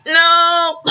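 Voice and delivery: high, drawn-out mock cry; retold outburst. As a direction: burst out with a high, hammed-up refusal. Retold sudden outburst while choking.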